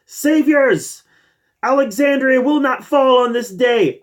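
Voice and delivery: high, loud battle cry; Ezekiel's yell. Retold or imitated. Reviewer quotes the season seven rallying cry, yelling as Ezekiel. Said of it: "and Ezekiel yells".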